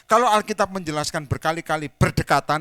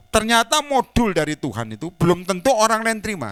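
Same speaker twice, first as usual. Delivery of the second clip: 1 loud preaching; 5 semitones higher, 5 dB louder than usual. Real, not acted. Preacher stressing message.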